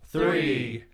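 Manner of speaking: group counting down, building to a loud cheer; new year countdown crowd. Pretend. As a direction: count down with the group, then shout it out.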